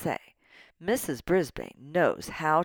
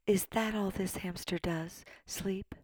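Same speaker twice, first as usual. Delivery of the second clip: soft talking; 3 semitones higher, 7 dB quieter than usual. A narrator's reading. Teacher asking about the sleeping hamster, soft talking. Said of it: "all that soft talking".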